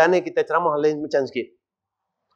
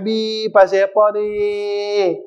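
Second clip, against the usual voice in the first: higher, long drawn-out mock wailing; imitated tearful wail; 4 semitones higher, much slower than usual. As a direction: draw out each question like someone crying. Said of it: "Dia teriak".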